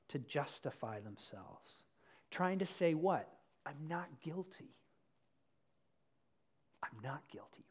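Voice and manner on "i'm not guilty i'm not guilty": soft, low muttered protest; soft, low self-defence; insisting innocence. Retold or imitated. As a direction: mutter your innocence to yourself.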